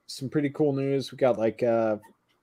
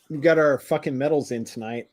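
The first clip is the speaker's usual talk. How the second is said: usual speaking voice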